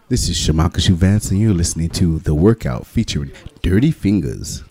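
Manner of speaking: low voice. Shown as a demonstration. Artist redoes the show drop, in a low voice. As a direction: read the drop a touch low. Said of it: "Can we do one low voice with that one?".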